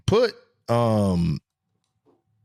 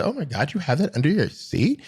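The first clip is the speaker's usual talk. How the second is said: impressed lady; 5 semitones higher than usual. Retold or imitated. Lady stops scooter rider to ask about his seat, impressed lady voice. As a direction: imitate an amazed lady passer-by. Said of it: "I had a lady stop me and say".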